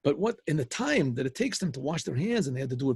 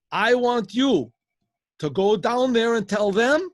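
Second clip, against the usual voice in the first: loud, slow, raised-pitch command; forceful order; 7 semitones higher, 7 dB louder, slower than usual. Acted. KGB head sending superintendent with a message, forceful order.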